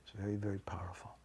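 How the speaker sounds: breathy hushed whisper, fast. Real, not acted. Teacher whispers the closing line to the class. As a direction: let it drop to a fast whisper.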